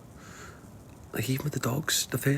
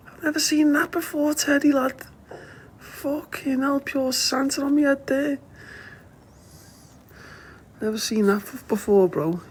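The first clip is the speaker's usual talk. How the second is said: much higher, delighted chatter; excited; 3 dB louder than usual. Real, not acted. Owner telling his dog Teddy about the bright light, excited and high.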